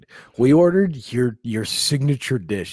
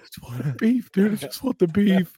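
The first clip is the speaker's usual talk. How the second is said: whining; 7 semitones higher than usual. Retold or imitated. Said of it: "whining for food".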